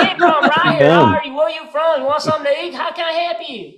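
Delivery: put-on loud, high, garbled Alabama accent; hammed-up unintelligible Alabama greeting. Alabama local greets comedian at club, garbled Alabama accent. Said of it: "didn't understand a word of English".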